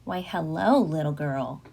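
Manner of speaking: put-on the wolf greets the little girl, low and smooth-talking; low-pitched, smiling charmer; charming wolf. A narrator's reading. Wolf greeting a little girl in the woods, falsely charming. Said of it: "he was smiling a charming smile".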